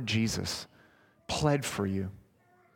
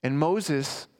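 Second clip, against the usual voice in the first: normal talking voice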